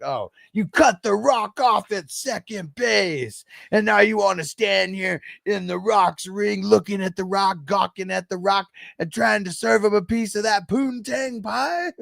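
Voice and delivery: high, hammed-up wrestler promo with a laugh in it; mock Rock promo. Imitating The Rock mocking Jerry Lawler's ex-girlfriend in the ring. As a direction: ham up The Rock trash talking. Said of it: "He was like".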